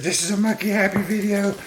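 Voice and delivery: very high cartoon monkey voice; high squeaky pet voice, proud. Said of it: "He said".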